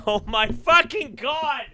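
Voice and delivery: high laughing squeal, slowed; cracking up at the donation message; laughing shriek. Real, not acted. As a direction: break up laughing as you scream it.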